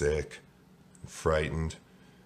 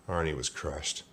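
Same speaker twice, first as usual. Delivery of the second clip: plain reading voice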